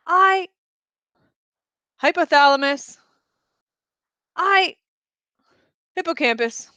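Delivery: cling to existence while dissolving; a mind pleading it still exists as it disappears; fading self. Acted. Consciousness dissolving during unwinding.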